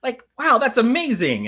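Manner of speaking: very high, wowed fan voice; re-enacted amazement. Recalling a nineties viewer seeing the cast, high-pitched amazement. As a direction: gush like an awestruck viewer.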